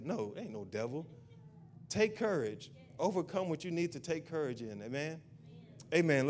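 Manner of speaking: normal talking voice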